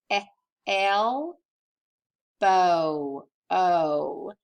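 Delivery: "Eh, el" short E, "bo, o" long O; phonics-style "Eh, el, bo, o", pauses between, vowels held; sounding out. Shown as a demonstration. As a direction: isolate each sound slowly: "Eh, el, bo, o". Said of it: "think about the sounds it makes".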